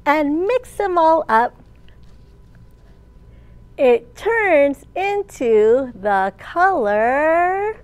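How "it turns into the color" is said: slow, rising build before the answer; drawn-out suspense. Shown as a demonstration. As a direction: stretch "It turns into the color" and leave it hanging.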